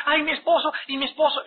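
mock lament. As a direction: moan the words like a worried wife.

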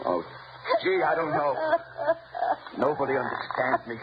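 high, giggling menace; a killer toying with his victim, laughing; mocking giggle.

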